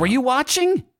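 very high, incredulous question; squeaky disbelief; Jake Cool. Acted. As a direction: ask it in a high, agitated voice. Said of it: "Jake Cool, I say".

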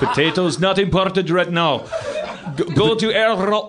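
loose, put-on Russian accent; a rough Russian captain voice. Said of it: "my Russian accent was pretty crap".

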